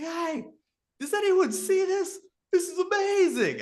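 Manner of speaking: high, loud excited call over the water; yelling across a lake. Retold or imitated. Stranger across the lake shouts about the aurora, excited yell. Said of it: "this guy yelling from across the lake".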